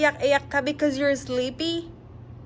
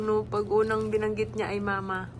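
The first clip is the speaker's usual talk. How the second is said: normal talking voice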